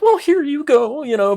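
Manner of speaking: very high, loud timid voice; high meek voice. Retold or imitated. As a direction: squeak it out like a weenie. Handing over money meekly.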